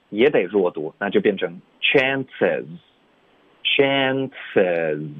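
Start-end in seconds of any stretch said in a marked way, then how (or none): 1.8-5.1 "chance as" joined into one word, "as" weakened; linked weak form. Shown as a demonstration. Teacher showing how chance meets as, linked weak form. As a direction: slowly link "chance" into a weak "as". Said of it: "也得连读，".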